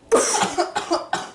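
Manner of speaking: a sharp, raspy cough; real cough. Real, not acted. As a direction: cough hard, struggling for air. Choking on sprayed pop.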